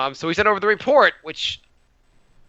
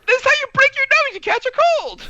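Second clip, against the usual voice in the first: put-on very high, loud frantic kid voice; mock hysteria; 5 dB louder than usual. Kids explaining their fear of colds, mock hysterical. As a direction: squeal the explanation in cartoonish panic.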